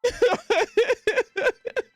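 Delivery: high rising laugh; giggling laugh. Real, not acted. Streamer giggling at Damani's joke, high laugh.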